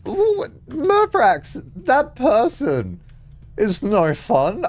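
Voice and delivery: very high, slow mock pout; silly squeaky voice. Teasing chatter Murphrax, very high slow cartoon voice. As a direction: drag it out in a tiny cartoon voice.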